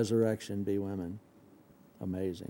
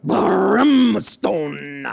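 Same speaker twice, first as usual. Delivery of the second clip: loud zealous shout; 6 semitones higher, 10 dB louder than usual. Acted. Disciples' zealous demand.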